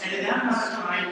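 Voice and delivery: usual speaking voice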